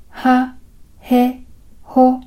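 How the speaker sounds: は へ ほ said slowly, each stressing its h; h consonant demo. Shown as a demonstration. Teacher showing Japanese h syllables as the model. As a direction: isolate the h in は へ ほ. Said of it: "この音素の発音として".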